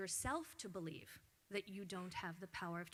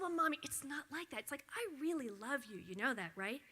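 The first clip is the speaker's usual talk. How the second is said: put-on higher, sweet little-boy voice, lightly done; imitating her son; 5 semitones higher, 5 dB louder than usual. Mother imitating her son explaining to Mommy, a light child voice. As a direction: play the earnest child explaining gently.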